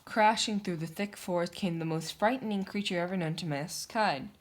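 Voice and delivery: usual speaking voice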